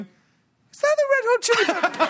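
very high-pitched mock squeal; imitated astonished woman. Woman spotting the band outside, squealing in disbelief. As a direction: squeal it like a woman stunned by celebrities.

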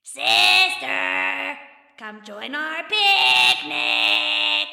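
high, slow, eerie goblin call. A narrator's reading. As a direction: lure her in with a singsong call. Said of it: "calling out to her".

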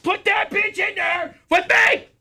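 high, slow, screaming in rage; furious yelling. Retold or imitated. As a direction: bellow it down the hallway. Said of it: "I'm screaming as soon as I".

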